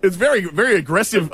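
ordinary talk, nothing marked

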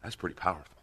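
usual speaking voice

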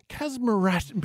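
"charismatic" tripped over and garbled; stumbled pronunciation. Real, not acted. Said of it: "I can't even get it out".

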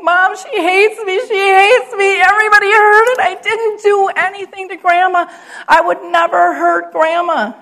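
high, loud distraught wailing to mom; imitated tearful daughter. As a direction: plead your innocence, high and upset, as a devastated teen. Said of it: "just crying, just crocodile tears, and her lips quivering".

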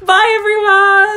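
high, loud, stretched goodbye; cheery goodbye. Real, not acted. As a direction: sing out a big farewell. Sign-off outro.

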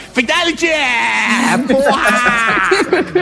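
high shouted fatality call; hyped announcer shout. Pretend. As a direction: scream it like a game announcer. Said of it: "Não só mudei a voz".

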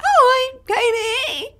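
put-on very high, loud squeaky child; squeaky little-kid greeting; kid's voice. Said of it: "like, a kid's voice".

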